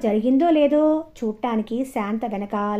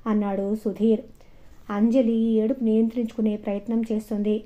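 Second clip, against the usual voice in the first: plain reading voice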